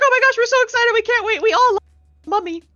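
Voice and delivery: high, loud fangirl chorus, imitated; excited gushing. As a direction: gush in a high squeal. Voices excited family members.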